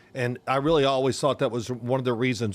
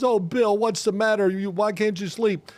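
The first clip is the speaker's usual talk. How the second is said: high, fussing wife voice; mock worried wife; 7 semitones higher than usual. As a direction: play his wife fretting at bedtime, pitched up. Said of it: "my wife says".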